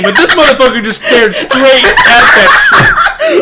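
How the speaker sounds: incredulous laughing rant. Real, not acted. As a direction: crack up while telling it. Laughing retelling of dodge.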